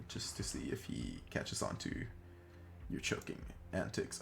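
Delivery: unvoiced whispered aside; whispering. Real, not acted. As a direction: whisper it to yourself.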